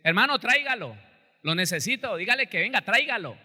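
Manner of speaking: insistent pastor calling for the brother; urgent pastor's order. Retold or imitated. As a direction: demand he bring him now.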